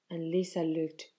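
plain reading voice

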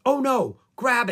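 high, loud urgent exclamation; alarmed. Acted. Shawnee hears the emergency call on the radio, alarmed.

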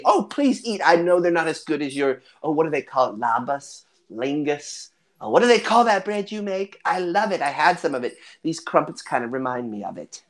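put-on old hostess rambling fondly, searching for a word; lilting grandmother voice; chatty hobbit grandmother.

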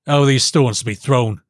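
sharp royal demand; stern kingly challenge. Acted. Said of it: "said the king sharply".

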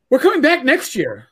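high, eager announcement; retold enthusiasm. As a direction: imitate a wife who's made up her mind. Retold wife's keen announcement.

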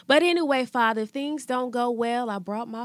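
loud, high prayer voice; loud preaching. Acted. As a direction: call it out to the Lord.

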